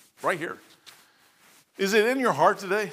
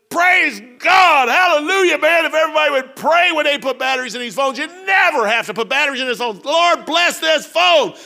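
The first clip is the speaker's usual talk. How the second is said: higher, louder hallelujah call; joyful praise shout; 8 semitones higher, 5 dB louder than usual. Retold or imitated. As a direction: shout praise over a friend's phone. Loud praise over coworker.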